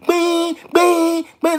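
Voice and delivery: high, drawn-out honking noise; imitated truck noise. Trucker retelling the near miss, mimicked blaring noise. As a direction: sound the big truck's horn with your voice. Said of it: "et puis ça fait bruit".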